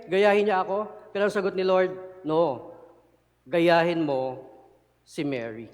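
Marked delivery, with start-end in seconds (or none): 2.2-5.7 gentle correction. Acted. The Lord answering Martha, preached.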